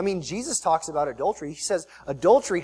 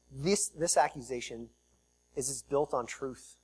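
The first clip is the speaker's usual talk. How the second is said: soft low statement, slowed; quiet; 3 semitones lower, 8 dB quieter than usual. Shown as a demonstration. Preacher to congregation on David's guilt, quiet weighty statement. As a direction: let it sink in quietly. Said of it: "This accusation, it's actually built on truth".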